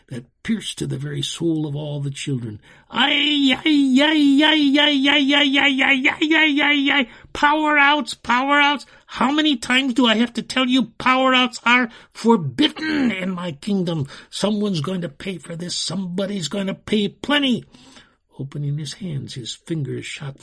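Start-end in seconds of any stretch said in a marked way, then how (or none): 2.9-7.1 high, very loud string of wailing shrieks; infuriated heart-stopping scream; piercing shriek. A narrator's reading. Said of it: "lifted his red head and screamed". 7.3-17.6 high, loud enchanter's tirade; infuriated screaming. A narrator's reading. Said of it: "Clearly infuriated".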